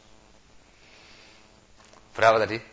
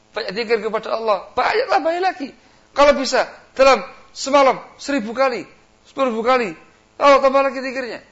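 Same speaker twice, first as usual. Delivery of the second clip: high, loud insistent urging; devil egging on; 13 semitones higher, 12 dB louder than usual. Pretend. Iblis urging a worshipper to do endless zikir, loud urging. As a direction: push for more and more.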